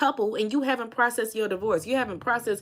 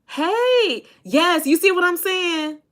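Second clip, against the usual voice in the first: excited call-out; 8 semitones higher, 5 dB louder, slower than usual. Real, not acted. Calling out to viewers.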